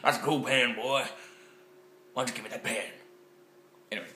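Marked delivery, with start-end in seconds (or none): 0.0-2.9 lightly voiced crazed stranger, louder; a hint of a grabby weirdo; crazed person. Vlogger imitating a crazed pen lover, mild mock menace. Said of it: "that's what in crazed people look like".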